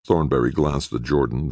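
ordinary delivery, nothing marked

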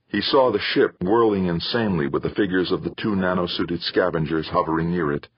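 usual speaking voice

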